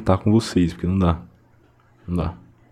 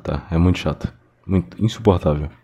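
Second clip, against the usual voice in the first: normal talking voice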